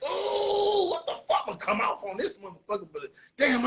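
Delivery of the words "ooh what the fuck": amazed high whoop. Retold or imitated. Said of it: "I'm like".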